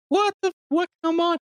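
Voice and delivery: high-pitched, wounded little protest; offended kid. Retold or imitated. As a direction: voice a hurt kid, hammed-up. Voicing offended, sad Goten.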